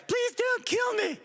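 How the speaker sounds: put-on high, slow screaming girl plea; girl victim voice. Friend playing the girl parts begging the killer, high and slow. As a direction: scream it like the terrified girl in a slasher film. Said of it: "I'll play all the girl parts".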